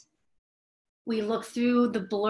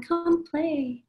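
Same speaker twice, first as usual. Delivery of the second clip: put-on high, sing-song child's plea; higher child voice coaxing to play; pleading child; 6 semitones higher than usual. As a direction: beg sweetly like a little child. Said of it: "inner child tugs at the heartstrings".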